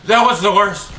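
normal talking voice

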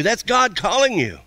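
ordinary talk

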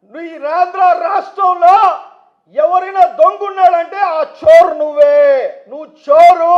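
loud, ringing public denunciation; shouted accusation. Real, not acted. Politician calls his rival a thief, shouted accusation. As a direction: hurl the accusation at full voice.

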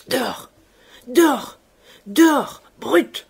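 short, loud bursts of fury; stammered rage. A narrator's reading. Enraged father ordering the servant out, stammering in furious bursts. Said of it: "Il balbutiait".

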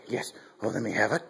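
usual speaking voice

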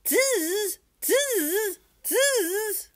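very high monkey squeal; high-pitched storybook monkey cry; chattering monkeys. Acted. Monkeys mimicking the peddler in story.

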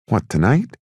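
high character voice. Acted. Cork voiced by narrator.

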